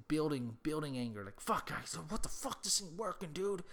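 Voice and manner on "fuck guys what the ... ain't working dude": mock frustrated cursing. Neighbour failing to light fire.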